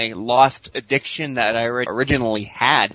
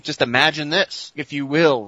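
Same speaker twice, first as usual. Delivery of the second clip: normal talking voice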